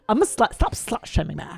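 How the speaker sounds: mock girl-speak protest; put-on girl talk; mimicking young women. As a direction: object in affected, exaggerated vowels. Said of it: "That is what they sound like".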